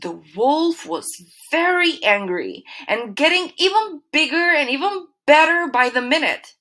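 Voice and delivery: usual speaking voice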